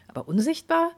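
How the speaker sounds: plain reading voice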